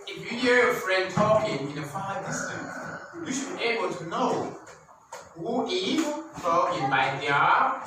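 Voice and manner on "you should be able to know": ordinary delivery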